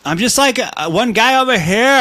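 high-pitched, loud Valley guy. Acted. Host refusing an impression request, in a high Valley guy voice. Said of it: "why he's a Valley guy now".

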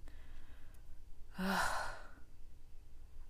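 a long, breathy sigh out; long heavy sigh. Real, not acted. Overwhelmed vlogger sighs heavily. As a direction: let out a heavy sigh of release.

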